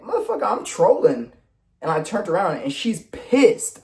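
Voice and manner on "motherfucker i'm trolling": mock protest. Retold protest that he was joking.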